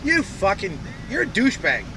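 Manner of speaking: usual speaking voice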